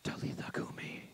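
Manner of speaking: unvoiced whisper; whispered Aramaic command. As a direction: breathe it like a secret. Repeated as whisper.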